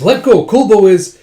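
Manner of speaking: loud, high demand; retold urgent outburst; acted agitated protest. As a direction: protest against being held back. Wounded man resists restraint.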